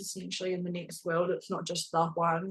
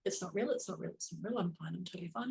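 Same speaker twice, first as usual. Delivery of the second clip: muttered denial; 9 dB quieter, faster than usual. Retold or imitated. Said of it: "and she was like".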